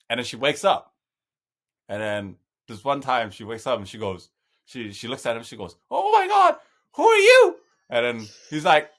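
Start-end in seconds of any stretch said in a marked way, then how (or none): 5.9-7.6 high startled mock old lady cry; imitating scared grandma. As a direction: play the confused grandma panicking. Grandma frightened by stranger.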